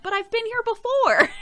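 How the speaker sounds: high, whiny complaining voice; high, plaintive protest; whiny viewer voice. Retold or imitated. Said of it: "they're like".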